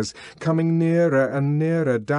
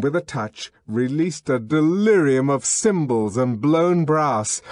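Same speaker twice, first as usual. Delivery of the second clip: usual speaking voice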